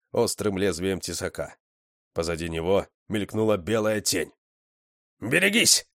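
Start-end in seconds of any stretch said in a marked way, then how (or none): 5.2-5.9 high-pitched urgent warning cry; barked warning. A narrator's reading. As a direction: bark out a sudden warning. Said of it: "рявкнул я". (рявкнуть, предупреждая)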